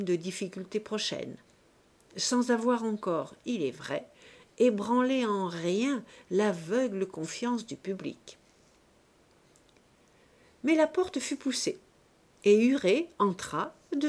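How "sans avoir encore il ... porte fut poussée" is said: plain reading voice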